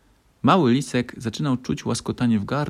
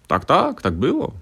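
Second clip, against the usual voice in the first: father admits; 7 semitones higher, 4 dB louder than usual. A narrator's reading. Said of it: "przyznał tata Liska".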